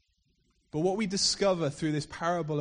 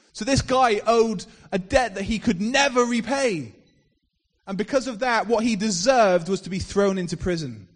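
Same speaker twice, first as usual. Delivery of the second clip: louder, driving parable retelling; preacher telling the parable to his church, building louder; preaching storyteller; 4 semitones higher, 7 dB louder than usual. Acted. Fiery sermon storytelling.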